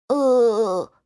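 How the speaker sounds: put-on child swallows a sob; short choked sob. Tiantian interrupts with a choked sob.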